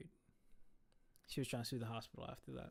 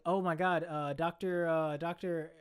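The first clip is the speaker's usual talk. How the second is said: louder, giddy techs straining for a name; excited gushing; 2 semitones higher, 7 dB louder, slower than usual. Retold or imitated. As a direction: fangirl over the famous ferret.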